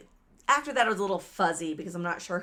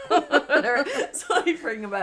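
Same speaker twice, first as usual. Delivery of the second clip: plain reading voice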